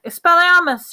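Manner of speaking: high, loud shouted incantation; croaked spell. A narrator's reading. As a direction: cast it with a sudden cry. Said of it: "he croaked".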